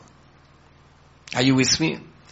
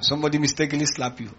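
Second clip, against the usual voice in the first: ordinary talk, nothing marked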